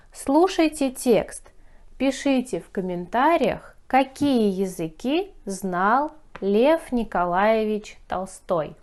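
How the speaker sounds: ordinary talk, nothing marked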